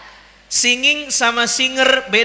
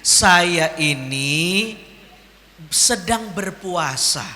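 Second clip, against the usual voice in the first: slow, low words to oneself; slow, deliberate self-reminder; self-talk; 6 semitones lower, much slower than usual. Acted. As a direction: remind yourself, drawn out and lower. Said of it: "Ngomong ke diri sendiri".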